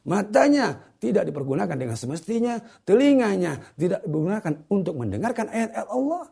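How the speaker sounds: high, emphatic list-like sermon build; forceful preaching. Real, not acted. Preacher summing up kalbu, mata, telinga, forceful preaching. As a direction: hammer out each point at a high pitch.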